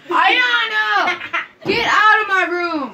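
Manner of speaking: loud, high shout with laughter; laughing, indignant yell. Real, not acted. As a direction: yell to throw someone out of the room. Ordering someone out of room.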